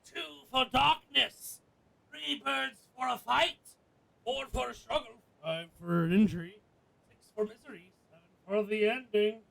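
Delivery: mild storybook rhyme recital; nursery rhyme reading. Acted. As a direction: read the rhyme like a nursery verse. Reads game rhyme aloud.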